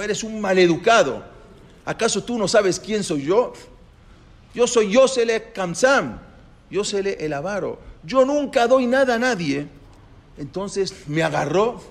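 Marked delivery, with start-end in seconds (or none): 0.0-9.7 high, loud, furious yell of a miser; furious shout. Acted. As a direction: roar it at the beggar, outraged. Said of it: "me gritó y me dijo". (el avaro le grita furioso a Moshe)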